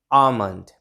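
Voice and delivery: आमंड with a silent L; correct almond pronunciation. Shown as a demonstration. Teacher contrasting the correct word for learners, silent L. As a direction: say आमंड, long first vowel straight into M. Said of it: "sound silent है".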